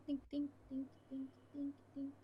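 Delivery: soft, high imitated dings fading away; distant dings. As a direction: make the far-off clink of metal, quiet. Glow stick hitting far below.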